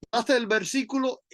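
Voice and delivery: ordinary talk, nothing marked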